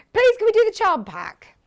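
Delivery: high, loud plea; high-pitched excited begging; pleading. Pretend. Mock plea, excited interjection.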